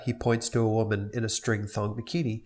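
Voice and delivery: ordinary talk, nothing marked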